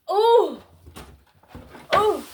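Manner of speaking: high, loud exclamations; sudden high bursts of delight; excited ohs. Real, not acted. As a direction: cry out in happy surprise. Spots more things she likes.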